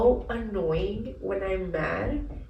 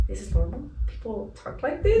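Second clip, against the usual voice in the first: shocked disbelief; 2 semitones higher than usual. Retold or imitated. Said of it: "It's like".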